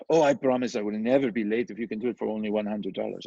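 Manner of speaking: a hint of a sweet Haitian French promise; Haitian French accent. Landlord imitating a Haitian buyer promising to pay on time, light Haitian accent. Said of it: "Haitian French accent".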